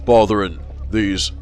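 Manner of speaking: sinister policeman warning the madman off, monotone; death-rattle constable. Acted. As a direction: speak like a ventriloquist's dummy. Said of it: "voice like a prolonged death rattle".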